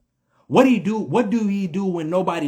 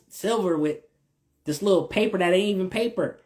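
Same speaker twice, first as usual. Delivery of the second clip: ordinary delivery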